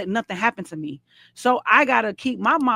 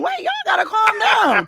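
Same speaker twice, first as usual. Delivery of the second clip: calming yell; 11 semitones higher, 5 dB louder than usual. Retold or imitated. Mother calming her fighting sons.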